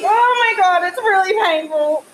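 high, loud pained cry. Real, not acted. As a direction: cry out from real pain. Laser pain.

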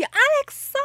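high, excited fangirl call of his name; excited fan call. Retold or imitated.

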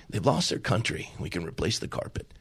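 a hint of a mother calmly forgiving; gentle mother. Mother tells her son gently why she is not angry.

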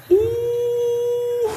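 long high-pitched whoop of amazement; high, sustained "ooh-hoo"; high drawn-out "ooh". Retold or imitated. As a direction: hoot softly at the size of the crowd. Exclaiming at crowded grocery store.

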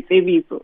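Tsebiso with an eh in the first syllable; common Zulu variant. Shown as a demonstration. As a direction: say Tsebiso as tseh-BEE-so. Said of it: "a lot of Zulu people say".

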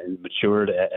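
ordinary talk, nothing marked

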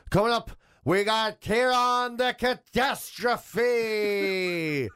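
slow, drawn-out hype introduction; fighting game announcer. Retold or imitated. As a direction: stretch the name like a ring announcer. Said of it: "the weather person would be there going".

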